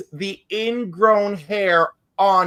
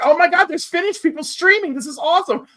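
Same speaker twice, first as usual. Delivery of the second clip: excited gushing; 9 semitones higher than usual. Retold or imitated. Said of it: "and I was like".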